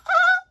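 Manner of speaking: a sudden high piercing howl of despair; anguished scream. Retold or imitated. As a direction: scream as if everything is lost. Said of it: "he's like".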